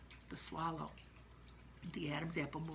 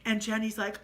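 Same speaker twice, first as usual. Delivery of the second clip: ordinary talk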